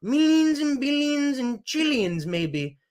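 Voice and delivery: Trump-style rally riff, repetitive and emphatic; Trump impression. Mocking right-wing talking points in a Trump impression. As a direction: do Trump, bragging and outraged by turns.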